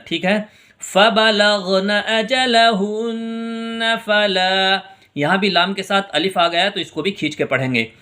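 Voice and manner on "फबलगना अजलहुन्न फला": slow, drawn-out melodic tajweed chant; Quran recitation. Acted. Teacher reciting verses for students.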